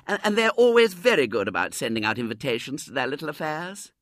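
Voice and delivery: high, loud lady's voice; chatty society lady. A narrator's reading. Lady chatting to Missus Brown, sociable high voice. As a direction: make sociable small talk in a lifted voice.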